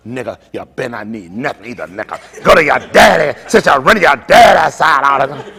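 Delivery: hammed-up wife scolding the kids; mock angry wife. Wife scolds the kids for siding with daddy, mock yell. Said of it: "She'll tell her".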